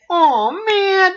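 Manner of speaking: very high, drawn-out cartoon groan; Swiper. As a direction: imitate a thwarted cartoon fox. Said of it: "The real Swiper's like".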